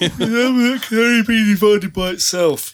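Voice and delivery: high, loud cartoon bear voice; goofy cartoon bear; Yogi Bear voice. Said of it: "sounded like Yogi Roo at a picnic".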